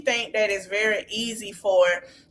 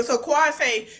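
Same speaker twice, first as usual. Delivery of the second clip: ordinary delivery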